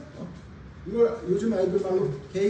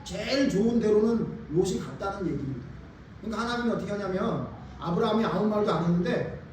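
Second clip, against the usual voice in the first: ordinary talk, nothing marked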